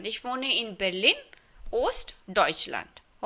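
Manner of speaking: each German word said very slowly and clearly; slow model sentence. Shown as a demonstration. Teacher modelling a Berlin resident's sentence, very slowly. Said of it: "तो आप कहेंगे".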